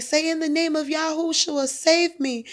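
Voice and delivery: high, loud prayer instruction; urgent prayer lead. Retold or imitated. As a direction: tell him firmly what to say. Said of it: "I was like".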